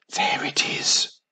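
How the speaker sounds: slow breathless whisper at the cave; excited whisper. Acted. Mum spots the cave, excited whisper. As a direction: hush it out, thrilled. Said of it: "whispered Mum excitedly".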